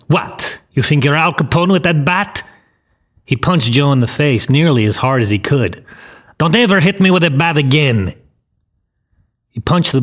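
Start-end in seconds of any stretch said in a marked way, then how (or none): 0.1-2.4 mildly raised, higher-pitched taunt; shouted challenge. A narrator's reading. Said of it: "he shouted". 6.4-8.1 higher-pitched, mildly raised threat; angry warning. A narrator's reading. Gangster threatening boy while beating him.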